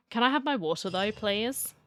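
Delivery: ordinary delivery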